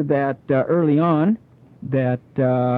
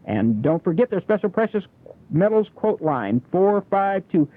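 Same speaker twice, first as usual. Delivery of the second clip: ad read; 6 semitones higher than usual. Acted.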